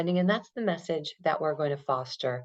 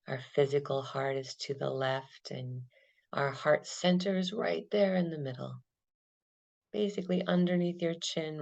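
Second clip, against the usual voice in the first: calm, unhurried meditation guide; guided meditation; 2 semitones lower, 5 dB quieter than usual. Acted. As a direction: lead them gently through the breath. Guided body-scan meditation.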